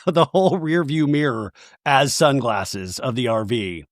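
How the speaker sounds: ordinary talk, nothing marked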